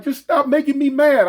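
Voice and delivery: a touch of her whiny voice; girlfriend's voice. Retold or imitated. Girlfriend voiced in retold fight.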